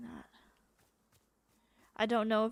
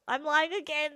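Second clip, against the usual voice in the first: exasperated laugh; 6 semitones higher, 8 dB louder than usual. Real, not acted. Caught lying to Jerry again.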